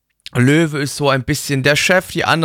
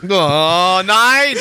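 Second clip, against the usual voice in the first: horrified no; 3 semitones higher than usual. Real, not acted. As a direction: recoil in mock horror. Reacting to uwu with horror.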